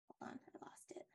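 whispered under her breath; whispered aside. Real, not acted. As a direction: mutter it in a whisper. Aside to herself mid-sentence.

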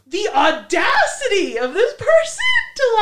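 high, laughing outrage; laughing disbelief. Real, not acted. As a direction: laugh through the words in disbelief. Laughing at the story.

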